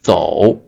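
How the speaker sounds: 走 low and dipping; third tone. Shown as a demonstration. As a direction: drop 走 to the bottom of the voice. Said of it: "the third tone".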